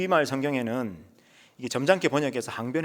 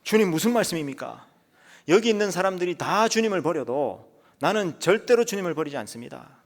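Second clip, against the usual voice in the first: higher, louder boastful Peter swearing loyalty; boastful vow; 6 semitones higher, 5 dB louder than usual. Acted. Peter boasting to Jesus, loud and proud. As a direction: proclaim it, chest out. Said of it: "베드로가 뭐라 그럽니까".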